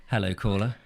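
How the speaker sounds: mock radio host. Partner answers like a radio host, mock.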